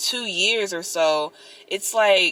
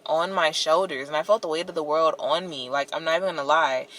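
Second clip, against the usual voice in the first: ordinary talk, nothing marked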